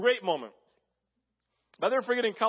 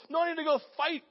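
restless protest; 12 semitones higher, faster than usual. Pretend. Voicing a restless believer.

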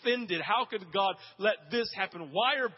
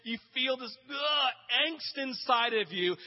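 sudden strained shout on the angst; anguished outburst; 4 semitones higher than usual. Acted. Preacher crying out the angst a restless heart feels, shouting. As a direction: push the frustration out with a groan.

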